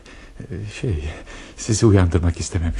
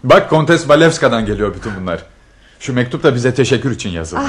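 excited; 6 semitones higher, 6 dB louder than usual. Acted. Excited about arrived gifts.

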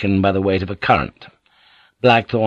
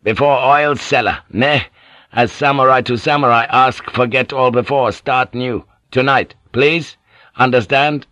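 usual speaking voice

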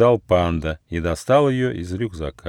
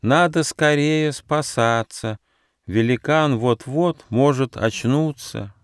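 slow, high turtle voice; turtle exclaiming; 5 semitones higher, slower than usual. A narrator's reading. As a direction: urge escape in drawn-out words. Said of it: "она воскликнула".

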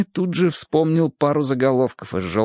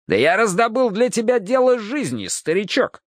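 higher, pleased with himself; boastful cop voice; 9 semitones higher than usual. Acted. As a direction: sell the big case. Hardwick boasting. (хвастливо, бодро)

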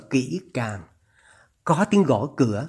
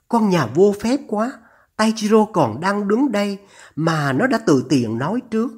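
higher-pitched, scolding mother's voice; indignant mother; 5 semitones higher than usual. Acted. (giọng mẹ cao, bực bội mắng)

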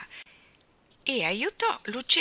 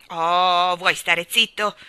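cross interruption; 11 dB louder, much slower than usual. A narrator's reading. Mary Poppins silences the starling, cross and loud. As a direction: cut in loudly, each word weighted. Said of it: "lo interruppe Mary Poppins".

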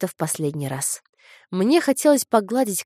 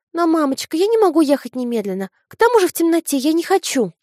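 высокий громкий детский голос; девочка упирается; упрямый ребёнок; 10 semitones higher, 6 dB louder than usual. A narrator's reading. Дочь отказывается ехать. (упрямый детский голос)

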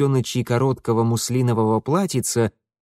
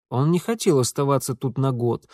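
ordinary delivery

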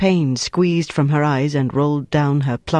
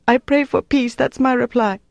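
very high, distressed woman's voice; desperate reply; 9 semitones higher than usual. A narrator's reading. Prisoner giving her interrogator the last answer, desperate reply. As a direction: blurt out the answer, pitched high in anguish.

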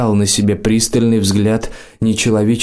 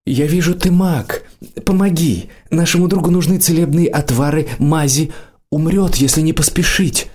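higher, quicker, urgent pleading; 7 semitones higher than usual. A narrator's reading. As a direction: beg the old mage for help, voice pitched up. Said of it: "Олег сказал просительно".

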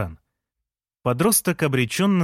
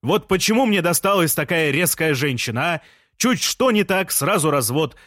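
high, raised haranguing; insistent, high-pitched calling; shouted complaint; 6 semitones higher than usual. A narrator's reading. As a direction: holler grievances up at a window. Жан keeps shouting from street.

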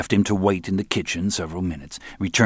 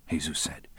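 ordinary delivery, nothing marked

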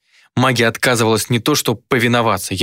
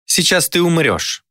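slow, higher bored demon; 8 semitones higher, slower than usual. A narrator's reading. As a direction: announce death as if bored. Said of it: "скучающим тоном".

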